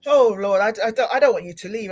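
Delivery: ordinary talk, nothing marked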